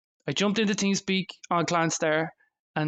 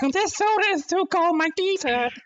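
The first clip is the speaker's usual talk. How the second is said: put-on high, squeaky child whine; whiny little kid; 13 semitones higher than usual. Mocking his younger self, high squeaky kid voice. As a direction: whine like a small boy.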